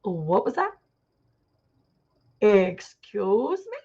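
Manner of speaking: affronted, slow "Excuse me?" with a rising tune; indignant ego voice. Retold or imitated. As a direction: play the offended ego: mock outrage, dragging out the last one. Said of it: "its antenna goes".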